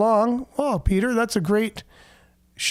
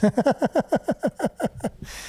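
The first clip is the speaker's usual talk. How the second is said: long, high run of chuckles; real laugh; 6 semitones higher than usual. Real, not acted. Host laughing at listener's grandma joke, amused chuckling. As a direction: laugh helplessly, tailing off.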